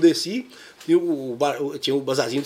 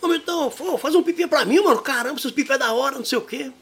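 put-on higher, eager kid voice begging for a kite; neighbor kid; 9 semitones higher than usual. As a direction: play the teenage neighbor asking a favor. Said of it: "Aí ele". (vizinho adolescente pedindo uma pipa, voz aguda de moleque)

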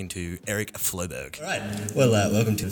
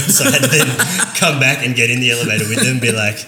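laughing; 4 semitones higher, 11 dB louder than usual. Real, not acted. Laughing at own story.

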